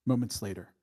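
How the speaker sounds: normal talking voice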